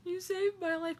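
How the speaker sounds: high, choked-up thanks; tearful gratitude. Retold or imitated. Fan thanking Barbara through tears, high and choked. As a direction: say it as if close to tears.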